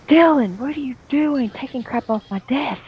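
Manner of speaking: high, shrill scolding teacher; angry teacher. Retold or imitated. Teacher scolds Dylan for taking from her desk. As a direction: snap at the kid for stealing. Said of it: "she was like".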